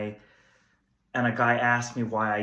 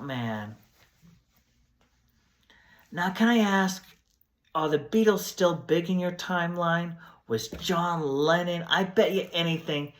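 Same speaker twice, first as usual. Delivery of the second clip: eager hippie voice, pitched up; hopeful flower child, higher voice; groovy hippie; 4 semitones higher than usual. Acted. Plays groovy time-traveling guest.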